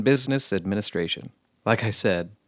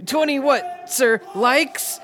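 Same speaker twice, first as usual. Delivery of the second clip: recruit shout; 13 semitones higher, much slower than usual. Acted. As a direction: snap it out to the sergeant. Recruit answers sergeant.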